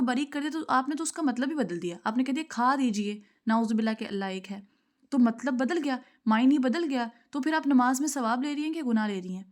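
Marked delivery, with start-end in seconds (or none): none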